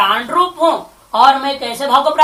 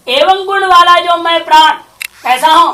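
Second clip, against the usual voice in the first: emphatic declaration; 7 semitones higher, 9 dB louder than usual. Shown as a demonstration. Booming recitation of the fruit verse.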